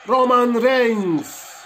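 high, long-drawn wrestler introduction; ring announcer. Pretend. Gamer introduces Roman as the match starts, mock announcer call. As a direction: stretch out the name in a big announcer call.

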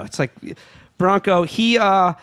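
normal talking voice

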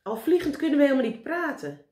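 high, loud scolding; exasperated. A narrator's reading. Meneer Pen orders the flying Karel to land, exasperated. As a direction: order someone down, out of patience. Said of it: "stampte met zijn voet op de grond".

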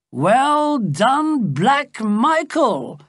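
high, drawn-out cheer; exultant cry. A narrator's reading. The drinking king salutes his brother's gift, exultant cry. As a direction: cry it out slowly and high, relishing the name. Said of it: "the king cried".

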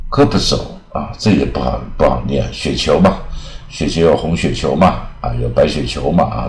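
normal talking voice

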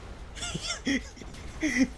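sudden high cackle; high burst of laughter. Real, not acted. Laughs at enemies in his hits.

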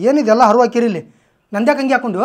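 loud, indignant demand; angry scolding. Acted. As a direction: scold them loudly over the mess. Finds his clothes strewn and worn.

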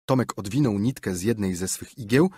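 plain reading voice

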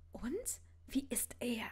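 hushed, curious question; eager whisper. A narrator's reading. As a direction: lean in and ask quietly, eager to know. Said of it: "fragte Ginny gespannt". (neugierig geflüstert)

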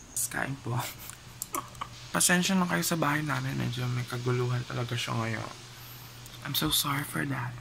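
usual speaking voice

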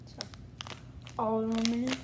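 talking while chewing candy. Real, not acted. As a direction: mouth partly full. Seen 2: Puts small candy in mouth, lips closed chewing early; later speaks normally.

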